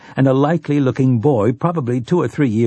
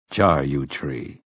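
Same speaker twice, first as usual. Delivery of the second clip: low, slow and mild; lower, slower, mild greeting; 7 semitones lower, 4 dB quieter, slower than usual. A narrator's reading. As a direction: say it gently, unhurried. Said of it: "Roland said mildly".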